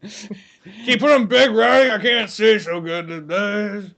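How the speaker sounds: slow, lightly voiced haggard old actor; a hint of a bleary old man squinting at cue cards; slow mock old-timer; mock Nolte. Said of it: "Nick, you don't even gotta act".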